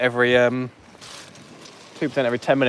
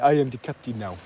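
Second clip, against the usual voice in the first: put-on Somali accent; Somali pirate impression; 4 dB quieter than usual. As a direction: imitate a Somali pirate taking over. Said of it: "We've got some Somali pirates".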